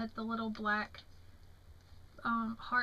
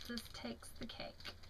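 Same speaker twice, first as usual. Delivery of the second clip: whisper; 11 dB quieter than usual. Real, not acted. Rereading the same card.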